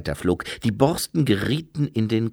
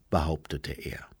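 ordinary delivery

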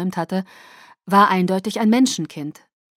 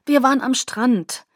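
high, raised tearful voice; 5 semitones higher, 5 dB louder than usual. A narrator's reading. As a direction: start confessing through tears. Tearful narrator begins telling dream.

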